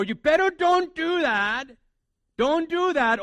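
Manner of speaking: loud, high, drawn-out warning; scolding parent. Acted. Parent warning a child off, loud scolding order. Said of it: "you as a parent, when you say it".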